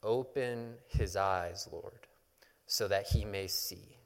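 slow, hushed prayer, fading at the end; prayerful. A narrator's reading. Elisha praying for his frightened servant, hushed and prayerful. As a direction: pray it slowly and softly. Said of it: "And Elisha prayed".

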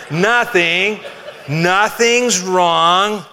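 imitating an upset partner insisting all is fine; very slow, laughing "nothing's wrong"; sulky spouse. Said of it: "what do they say about half the time".

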